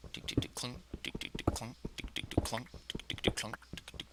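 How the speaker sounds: normal talking voice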